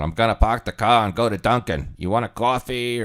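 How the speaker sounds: put-on Boston accent. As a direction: play a Boston local offering coffee.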